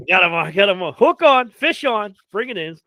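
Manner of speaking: loud fishing call; hollering fish on; excited. Pretend.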